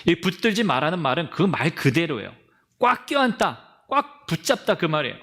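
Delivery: usual speaking voice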